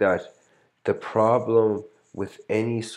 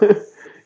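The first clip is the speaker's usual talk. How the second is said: burst of laughter; 12 dB louder than usual. Real, not acted. Laughing at own story.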